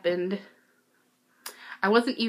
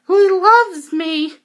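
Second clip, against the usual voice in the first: much higher, louder, slow lovestruck squeal; giddy squeal; 10 semitones higher, 10 dB louder, slower than usual. Retold or imitated. Fan swooning after her idol's hug, giddy squeal. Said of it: "And I was like".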